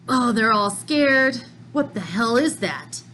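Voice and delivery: normal talking voice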